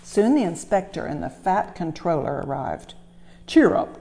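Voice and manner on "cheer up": high, bright cheer; cheering up a sad engine; cheery encouragement. Acted. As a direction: lift the engine's spirits. Controller comforts Thomas.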